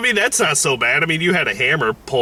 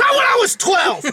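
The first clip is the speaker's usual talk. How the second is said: shout. Real, not acted. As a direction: yell it in protest. Shouted protest.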